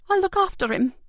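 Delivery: high, strained woman's voice; a jittery housekeeper; nervous carer. Acted. Carer answering the detective, nervous. Janet's character voice.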